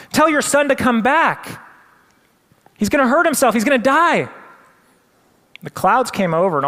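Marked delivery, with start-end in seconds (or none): 0.1-4.3 high, loud frantic begging; pleading with the mother. Retold or imitated. Crowd begging the mother, high and loud. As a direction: urge the mother to stop him. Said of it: "they're tugging on his mom".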